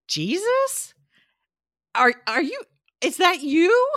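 high, loud, incredulous retold question; halting, squeaky-high disbelief, played for laughs; startled, tentative question. Retells startled question to painting.

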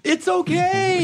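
high, slow mock reassurance; mock reassuring. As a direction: coo it in a silly high voice.

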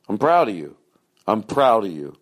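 normal talking voice

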